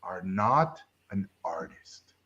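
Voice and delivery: slow, softened solemn verdict; Yoda. Retold or imitated. Old reviewer crushing a student's hopes, imitated slow soft sage. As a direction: imitate a sage, quietly pausing between words. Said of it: "he talked like Yoda".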